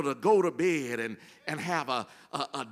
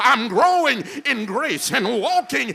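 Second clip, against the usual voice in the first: building from firm to a loud, higher preacher's shout; preaching at full force; 7 semitones higher, 10 dB louder than usual. Real, not acted. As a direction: whip the church up line by line. Call-and-response climax, building.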